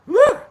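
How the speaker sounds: high loud woo; cheer. Real, not acted. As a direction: whoop for a friend. Cheering for Belinda.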